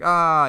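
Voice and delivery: high, loud "ah"; scream of pain. Acted. Villain hit by the shot goes flying back, acted scream. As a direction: cry out as if knocked flying.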